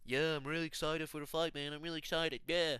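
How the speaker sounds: casual mock interview voice, lightly voiced; mock calm fighter. Fighter before the fight, mocked casually. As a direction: shrug it off like a relaxed fighter. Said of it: "he's like".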